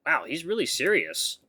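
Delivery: plain reading voice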